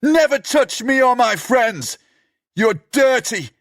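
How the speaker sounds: very high, slow screaming; furious shout. Acted. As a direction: scream to keep him away. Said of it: "Charlie shouts".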